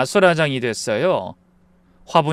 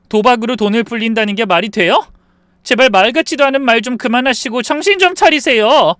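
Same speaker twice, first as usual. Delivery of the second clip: loud, high-pitched reproach; exasperated scolding; 7 semitones higher, 6 dB louder than usual. Acted. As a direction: plead and scold at the end of patience. Daughter-in-law scolds gambling mother-in-law.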